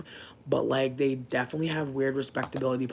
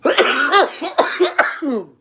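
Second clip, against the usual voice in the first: big real laugh; loud laugh; 13 semitones higher, 12 dB louder than usual. Real, not acted. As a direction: burst of high, loud laughter. Cracking up at South Park.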